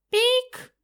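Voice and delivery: shrill synthetic ping; high electronic beep. Acted. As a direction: beep like a machine. Implant beep sound.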